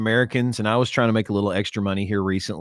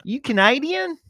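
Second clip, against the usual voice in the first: Kentucky lady; 13 semitones higher, 3 dB louder than usual. Retold or imitated. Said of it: "lady at Murray State always asked".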